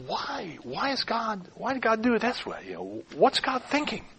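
hushed, wondering questions; musing. Acted. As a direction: ponder it softly, as if puzzling it out alone. Models quietly pondering God's reasons.

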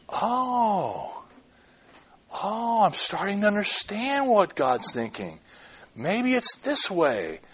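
long, hushed "oh" of discovery; quiet musing as understanding dawns; dawning realization. Acted.

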